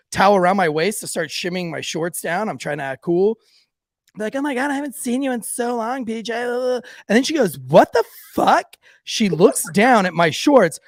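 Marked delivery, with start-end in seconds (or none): 4.3-6.3 high-pitched mock girly gushing; gushing girls. Girls gush while hugging the friend. 7.6-8.7 loud, high, drawn-out disbelief; shocked girl. Retold or imitated. Girl notices the mess, shocked.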